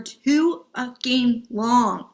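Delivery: too fucking long stretched slowly; drawn-out emphasis. Real, not acted. Coach admitting hyper independence, slow heavy stress.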